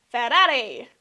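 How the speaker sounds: rolled r's in a loud Italian flourish; mock Italian accent. Introducing the next team to viewers, mock Italian accent. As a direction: say "Ferrari" with tapped Italian r's, loudly.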